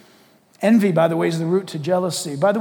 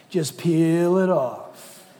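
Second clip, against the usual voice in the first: very slow, lower, stretched phrase; drawn-out slow; 4 semitones lower, much slower than usual. Acted. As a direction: draw each word out slowly. Slow emphatic repeat of phrase.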